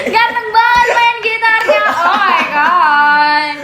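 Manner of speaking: high, drawn-out mock swoon; hammed-up fan gushing, laughing; gushing fangirl. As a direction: squeal over him like a smitten fan, stretching the last words. Acting out gushing fan messages.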